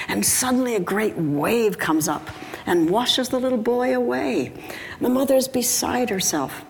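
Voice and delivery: high-pitched, mock-dramatic narration; urgent storytelling. A narrator's reading. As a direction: tell it as a mounting emergency. Joke turns to frantic crisis.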